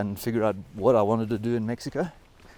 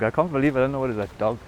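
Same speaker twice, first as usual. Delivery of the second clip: ordinary talk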